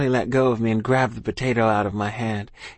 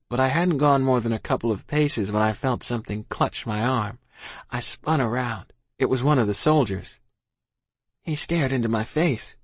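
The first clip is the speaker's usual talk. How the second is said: plain reading voice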